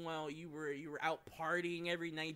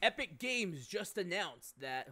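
slow, hammed-up breaking news read; news announcer voice; 5 semitones higher, 7 dB louder, slower than usual. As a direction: announce it like a TV anchor. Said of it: "That's not gonna be a bit".

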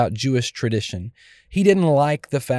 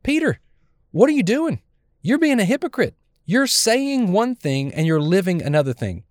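calling out. Retold or imitated. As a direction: call him out to his face, pitch up. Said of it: "He calls him out".

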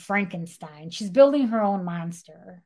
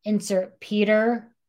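normal talking voice

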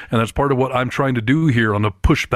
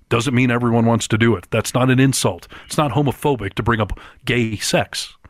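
ordinary delivery

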